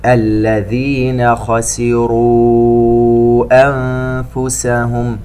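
slow chanted tajweed recitation; Quran recitation. Acted. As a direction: draw out each vowel, chant it. (تلاوة مرتلة بطيئة)

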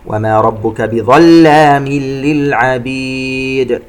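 slow chant, "بظلام" merged into l, bounced d in "للعبيد"; idgham without ghunna. Shown as a demonstration. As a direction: recite slowly, no hum into "للعبيد". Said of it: "إدغام بغير غنة". (ترتيل بإدغام "بظلام للعبيد" وقلقلة الدال)

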